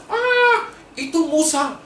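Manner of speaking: high, startled gasp of recognition; the people below suddenly spot Moses alive; surprised crowd. Acted. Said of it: "Yang dari bawah melihat ke atas".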